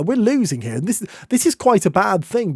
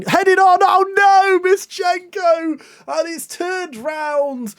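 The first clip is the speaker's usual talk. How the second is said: high, drawn-out cry of disbelief; dismayed outcry; 11 semitones higher, much slower than usual. Real, not acted. Watching his side fall behind, slow high disbelief. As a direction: groan it out, slowly, as it goes in. Conceding the go-ahead goal.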